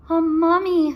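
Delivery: high, loud exclaim; Annie excited. A narrator's reading. Annie spotting the mummy, loud high exclaim. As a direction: cry it out in alarm. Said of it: "said Annie".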